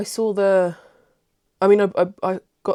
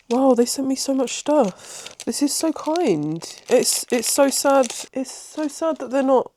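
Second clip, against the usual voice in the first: touched and tearful; 7 semitones higher than usual. Real, not acted. Touched by a gift.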